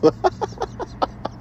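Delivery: airy squeaky giggle; high breathy laugh. Real, not acted. Laughs at pricey phone.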